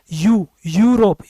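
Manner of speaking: यूरोप drawn out slowly, opening on a clear y sound; slow demo word. Shown as a demonstration. Teacher showing the first sound of a word. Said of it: "य की आवाज़ आ रही है".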